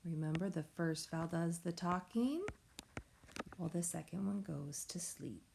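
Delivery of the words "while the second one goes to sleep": soft, whispery and sleepy; hushed. Shown as a demonstration. Teacher reminding pupils of the sleeping vowel, hushed and soft. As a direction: let it sink to a near-whisper, as if not to wake someone.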